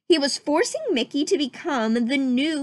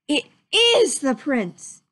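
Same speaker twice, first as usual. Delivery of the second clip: slow amazed exclamation; crying out; 3 semitones higher, slower than usual. Acted. Soldier recognizes the prince, slow amazed cry. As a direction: gasp it out in awe. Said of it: "he cried".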